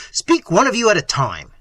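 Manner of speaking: impatient prince laying down the law; exasperated order. Acted. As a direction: rein in the babbling servants. Prince scolds babbling servants.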